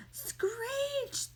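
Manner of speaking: breathy whispered screech sound effect; airy voiceless screech noise. Acted. Sound effect in comic reading.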